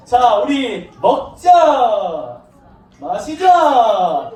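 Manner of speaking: long stretched-out party call; reveller's invitation. Acted. Younger son calling friends to party, drawn-out revelling. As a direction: draw out each call to feast.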